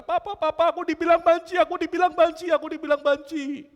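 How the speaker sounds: put-on high, loud, wailing child's plea; crying child. A crying boy tells his papa about the bullies, high and loud wail. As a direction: sob it out high and loud like a hurt little boy.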